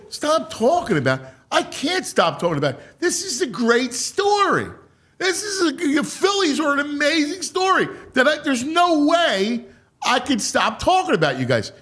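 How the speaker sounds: high-pitched, gushing objection; excited protest. Retold or imitated. Fan insisting the Phillies are an amazing story, excited protest. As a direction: argue back high and worked up.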